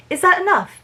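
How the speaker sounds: put-on high-pitched, imperfect British accent; attempted British accent. Flora asking over her shoulder, attempted British accent. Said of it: "That's not the right British accent for that".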